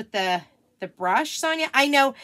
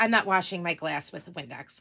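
ordinary talk

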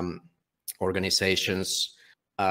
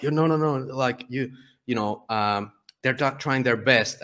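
higher-pitched imitation of protesting people; mock objection, pitched up; protesting defenders; 6 semitones higher than usual. People defending providers who try their best, protesting. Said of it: "a lot of people are like".

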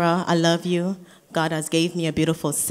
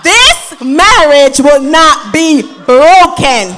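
slow, high, shouted proclamation; full-force preaching shout; 9 semitones higher, 13 dB louder, slower than usual. Real, not acted. Sister preaching to the wedding guests, slow and shouting high. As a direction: drag each word out, shouting to defeat the devil. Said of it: "one more time because the devil mad".

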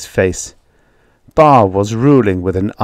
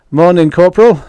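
barkeep voice; 9 semitones higher, 7 dB louder than usual. Acted. Barkeep greets soldier.

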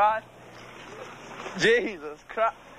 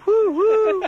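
high, loud train whistle hoot; train whistle woo; 7 dB louder than usual. Retold or imitated. Mimicking a train whistle at the party. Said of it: "I was going".

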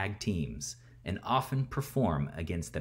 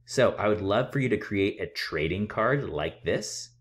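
ordinary delivery

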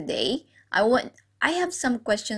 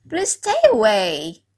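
ordinary talk

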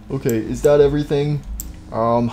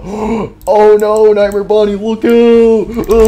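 loud, high, slow cry of alarm; hammed-up warning shout; 7 semitones higher, 9 dB louder, slower than usual. Soldier warning Nightmare Bonnie of danger, loud high shout. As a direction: yell the warning across the field.

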